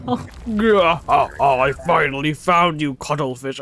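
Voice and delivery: lightly voiced dramatic villain; game character. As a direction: act out the moment of finding Cuttlefish.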